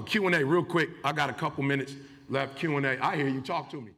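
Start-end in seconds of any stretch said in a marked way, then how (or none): none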